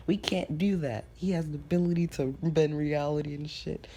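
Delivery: high-pitched complaint from the classmates; protesting. Retold or imitated. Classmates told to follow the role model, protesting. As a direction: push the voice up in indignant protest.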